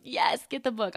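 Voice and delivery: upbeat, excited plug; enthusiastic. Pretend. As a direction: urge listeners on with excitement. Imagined enthusiastic recommendation to listeners.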